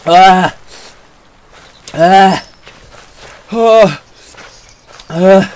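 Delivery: loud gasps of burning pain. Real, not acted. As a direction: gasp through the burn. Pain after eating habanero.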